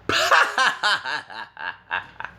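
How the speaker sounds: loud burst of cackling laughter; laughing. Real, not acted. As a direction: cackle long, then run out of breath. Cackles at the man needing the wall.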